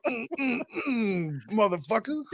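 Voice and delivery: high, strained wrestler flexing grunts; Hulk Hogan. Acted. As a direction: grunt through a mock muscle flex. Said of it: "Gave it the Hulk Hogan".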